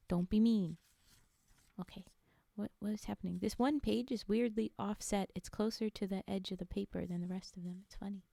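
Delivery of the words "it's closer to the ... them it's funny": whisper. Real, not acted. Whispered aside while reading.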